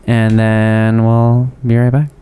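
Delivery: slow announcer drawl into break; radio voice. Acted. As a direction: stretch it like a radio host. Radio ad transition voice.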